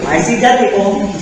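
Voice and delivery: put-on a light hushed nod to the film's boy; quoting the famous line with a wink; lightly voiced Sixth Sense boy; movie quote. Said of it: "That movie".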